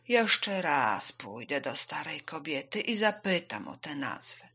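plodding, drawn-out speech; unhurried tortoise voice; slow, patient tortoise. Acted. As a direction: offer it slowly and steadily. Tortoise volunteers.

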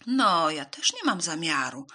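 loud storybook mouse refusal; refusing mouse. A narrator's reading.

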